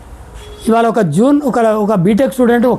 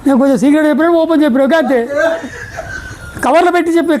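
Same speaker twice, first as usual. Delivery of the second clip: quick words broken by real laughter; laughing while talking; 6 semitones higher, faster than usual. Real, not acted. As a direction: chuckle through the joke. Laughs while joking about secrets. (నవ్వుతూ వేగంగా మాట్లాడటం)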